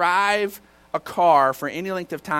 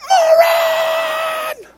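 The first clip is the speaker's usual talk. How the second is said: very high, drawn-out yell; furious shout; 7 dB louder than usual. Acted. Driver slamming on brakes for someone pulling out, enraged. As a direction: scream the insult in comic road rage.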